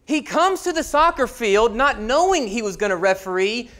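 ordinary talk, nothing marked

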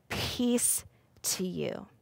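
slow, very soft blessing; gentle, hushed and slow; calm reassurance. Acted. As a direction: soothe frightened friends, unhurried. Voices Jesus calming frightened friends.

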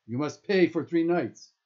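high, loud parting order; shouted demand. A narrator's reading. Guard leaving with the backpack, loud demand. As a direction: call it out loudly as a demand.

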